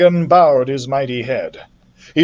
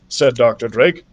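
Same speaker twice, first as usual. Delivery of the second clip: usual speaking voice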